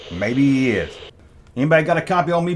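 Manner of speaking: normal talking voice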